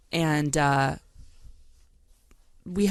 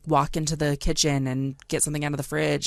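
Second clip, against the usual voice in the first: ordinary delivery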